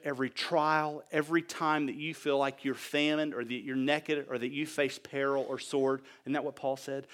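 normal talking voice

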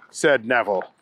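ordinary delivery, nothing marked

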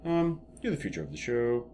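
soft, low, halting producer voice; Lorne Michaels. Retold or imitated. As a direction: placate him quietly with long pauses. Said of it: "And Lorne goes".

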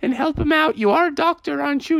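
high, urgent mom on phone; pleading mother. Acted. Mother phones doctor about blasted husband, urgent pleading. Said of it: "And she said".

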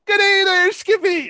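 high, loud Newfoundland greeting; imitated Newfie kid. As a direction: call out like an excited Newfie kid. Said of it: "he sounds like he's, like, a newfie".

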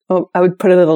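ordinary delivery

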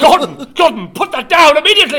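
high, loud, fast telling-off; scolding shout. Acted. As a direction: shout at him to stop. Scolding Gordon.

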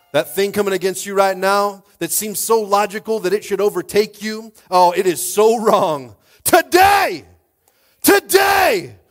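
loud, high preaching building to shouts; full-force preaching. Real, not acted. Pastor rallying people against what comes against them, shouted preaching.

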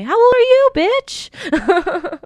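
high, loud playful outrage; mock offended. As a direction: pretend to be insulted, laughing. Mock offended outburst among friends.

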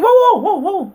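high, loud string of whoas; mock alarm. Retelling how he stopped an angry fan, mock alarmed. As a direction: act out halting someone, voice jumping up.

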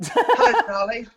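high, loud laughing hello; excited hello. Real, not acted. Greeting Charlie on split screen, high and laughing. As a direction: burst out a delighted hello.

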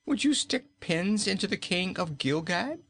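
high indignant fat king; a king shocked at the threat; aghast king. Acted. King protests to cruel queen, high and aghast. Rinkitink voiced, horrified.

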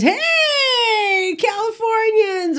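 high, slow, sing-song greeting; a put-on cheery store clerk; mimicking Junior.

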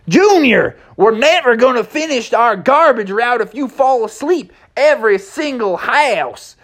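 mildly exasperated horse. Acted. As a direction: scold the sleepy cub. Mustang scolding Junior.

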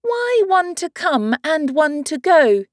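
put-on a curious young girl; Alice. Alice asks.

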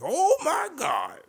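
very high, loud, slow falsetto squeal; stretched falsetto shriek; Laughing Lenny impression. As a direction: shriek it out like a giddy comic. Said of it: "that's how he sounds".

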